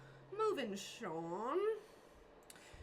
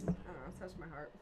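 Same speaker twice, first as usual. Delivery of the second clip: usual speaking voice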